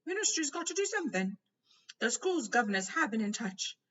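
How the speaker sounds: a fretting official pleading his case; lightly voiced Fudge. A narrator's reading.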